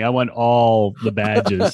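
drawn out, every word spelled out; slow insistent demand. Pretend. Host slowly insisting to his co-host he wants all badges. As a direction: hammer home that you want ALL the badges. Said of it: "I wanna make sure you understand me".

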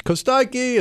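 high call across the beach. Retold or imitated. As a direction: shout a name on the beach. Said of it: "you hear on the beach".

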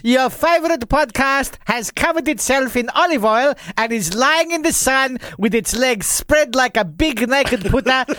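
upbeat show opening announcement; podcast host intro. Acted.

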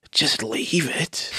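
hushed, slow disbelieving echo; "Just leave it?" echoed in a hushed, slow, disbelieving way; stunned disbelief. Retold or imitated. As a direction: repeat it back, stunned and quiet. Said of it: "And I was like".

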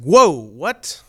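sudden loud startled exclamation. Retold or imitated. Alone in the chapel at night, startled outcry. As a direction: jump at the unexpected voice.

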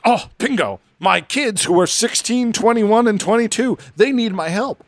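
high, eager mock delight. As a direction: chirp it like a sudden jackpot. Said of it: "He goes".

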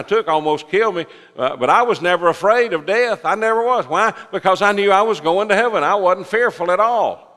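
high, loud, emphatic testimony; fervent preaching. Real, not acted. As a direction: declare it boldly to the congregation. Preaches own testimony forcefully.

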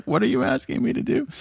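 bewildered, rising to a high squeak; mock-puzzled question; mock confusion. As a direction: play the baffled listener. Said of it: "It's like".

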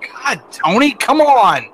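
exasperated "come on!"; retold exasperation. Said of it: "everybody would, like, roll into him and be like".

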